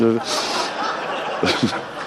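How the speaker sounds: helpless quiet laughter; long unvoiced giggle; long breathy laugh. Real, not acted. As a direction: laugh soundlessly at yourself. Laughing at his own joke.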